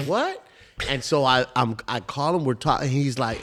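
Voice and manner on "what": high-pitched questioning "what?"; incredulous reaction. Retold or imitated. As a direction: retell a disbelieving reaction, voice shooting up. Retold reaction to robbery news.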